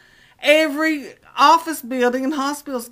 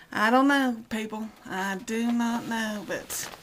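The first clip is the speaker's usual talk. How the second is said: normal talking voice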